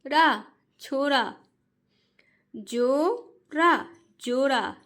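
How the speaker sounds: "को" and "रा" split apart, then "कोरा" joined slowly; syllable-by-syllable reading. Shown as a demonstration. Teacher shows parents how children should read, split then blended. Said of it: "जिस तरीके से मैं पढ़ रही हूँ".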